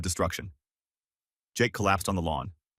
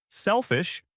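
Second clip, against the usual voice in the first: much higher, scornful echo; thundering; 12 semitones higher than usual. Acted. Mother throwing the word back at her husband in outrage. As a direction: fling the word back at him. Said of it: "Her voice cracked like thunder".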